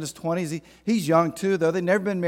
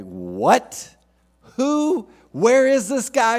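much higher and louder sudden disbelief; shocked outburst; 8 semitones higher, 7 dB louder, much slower than usual. Retold or imitated. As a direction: blurt it out, stunned and rising. Joseph reacting to the news.

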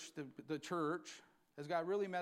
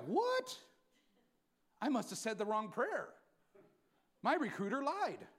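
a high yelp on what, then mock dismay; mock shock; 5 semitones higher, 4 dB louder than usual. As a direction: play the stunned new believer, half joking. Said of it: "you're like".

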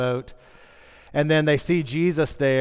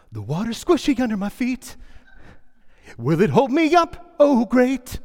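hammed-up stage Peter, higher; musical theatre Peter; 8 semitones higher than usual. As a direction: play it like a cheesy church musical. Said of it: "I even wanted to act out a musical".

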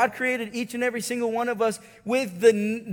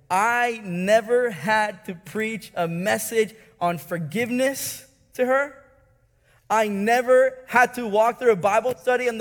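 louder and higher, building preacher's cry; preaching at full force; 6 semitones higher, 6 dB louder than usual. Acted. Preacher telling the congregation how the Spirit convicted her, full-force preaching. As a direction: drive it home to the congregation.

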